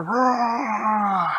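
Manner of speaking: huge, high, very loud monster roar. Acted. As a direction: roar right into their hiding place. Giant monster right at tube.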